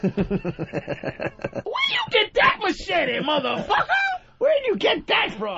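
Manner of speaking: high-pitched, hammed-up astonishment; clueless movie bystander. Oblivious bystander asking Jason about his machete, hammed-up wonder. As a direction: call out in comic amazement.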